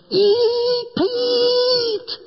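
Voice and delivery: put-on high kid's yell, bright and sudden; child's high-pitched call; grandkid's shout. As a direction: shout it like an excited grandchild at the table. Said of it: "one of my grandkids goes".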